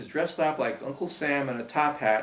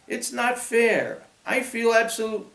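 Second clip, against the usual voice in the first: put-on upset girl; 10 semitones higher, 6 dB louder than usual. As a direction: protest on the edge of tears. Grace upset, then coughing.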